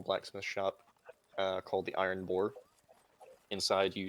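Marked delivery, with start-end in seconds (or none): none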